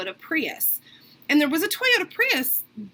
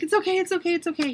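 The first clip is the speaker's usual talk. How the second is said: quick, higher-pitched string of soothing; imitated pleading reassurance; 6 semitones higher, faster than usual. Taxi driver begging a passenger to stop crying, pleading. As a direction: beg the crier to stop. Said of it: "he was, like, begging me to stop crying".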